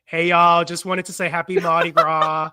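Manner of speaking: put-on American y'all greeting; American accent. Shown as a demonstration. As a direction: do a big American accent, slipping into Australian.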